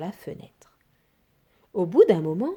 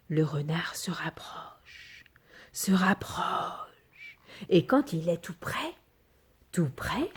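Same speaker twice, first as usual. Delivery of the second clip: slow whispered narration; suspense whisper; 2 semitones lower, 5 dB quieter, slower than usual. A narrator's reading. Storyteller building suspense for children, slow whisper. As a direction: whisper as the fox creeps closer.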